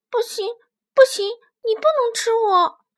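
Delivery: high, loud frightened squeal; shrieking baby dinosaur. A narrator's reading. Baby spinosaurus begging the tyrant dinosaur not to eat it, shrieking. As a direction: shriek it out in a tiny creature's panic. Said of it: "棘龙宝宝尖叫着".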